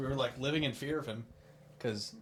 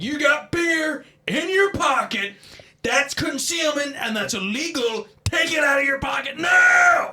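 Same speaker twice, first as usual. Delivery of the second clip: high, loud, slow mock shout; imitating shouting; 12 semitones higher, 10 dB louder, slower than usual. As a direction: berate him like a tiny store guard. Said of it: "He's like".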